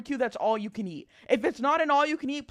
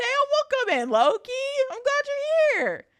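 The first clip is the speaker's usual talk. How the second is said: cute anime streamer; 14 semitones higher, 4 dB louder than usual. Real, not acted. Greeting viewer cutely.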